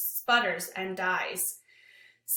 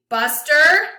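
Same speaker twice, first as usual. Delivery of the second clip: loud, high furious yell of the name; angry shout; 6 semitones higher, 14 dB louder than usual. Acted. Lewis yelling for his dog, furious shout. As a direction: bellow the dog's name in rage.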